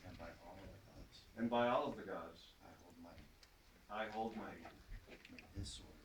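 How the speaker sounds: firm ceremonial vow; solemn knightly oath. Acted. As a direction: swear it proudly before the court. Knight repeats prompted oath aloud.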